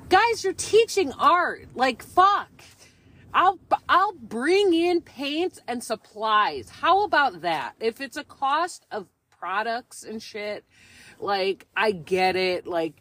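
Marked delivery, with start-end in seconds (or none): 0.1-7.7 much higher, slow, incredulous mock outburst; high, drawn-out sarcastic protest; exasperated rant. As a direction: imitate telling the school they're overcharging. Retold rant at pricey art class.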